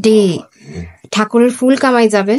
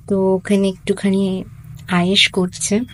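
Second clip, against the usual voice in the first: ordinary talk, nothing marked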